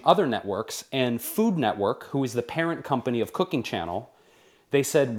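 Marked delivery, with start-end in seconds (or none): none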